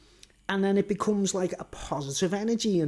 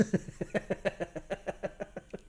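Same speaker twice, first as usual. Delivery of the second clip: long, breathy staccato laugh; wheezy chuckle; 10 dB quieter than usual. Real, not acted. Vlogger amused by his Buckingham Palace quip, wheezy laugh. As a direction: laugh wheezily at your own joke.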